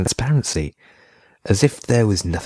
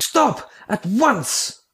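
put-on child screams stop, much higher; much slower than usual. A narrator's reading. Bodan the child screams at the photographers to stop. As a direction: shriek it out at them. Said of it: "Bodan screamed".

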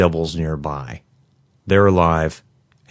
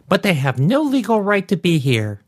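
high-pitched indignant woman; high, insistent grievance in a woman's voice; protesting woman; 12 semitones higher than usual. A narrator's reading. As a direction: pitch it way up and hold it there, complaining.

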